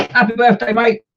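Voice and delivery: high, loud birthday cheer; excited cheer. Real, not acted. Host calls happy birthday to Connor, loud and high.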